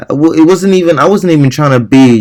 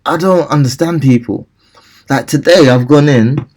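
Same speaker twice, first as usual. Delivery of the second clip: usual speaking voice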